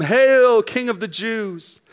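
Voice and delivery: loud mock cry of Hail, then slow; a sneering soldiers' salute. Preacher voicing the soldiers' mocking cry.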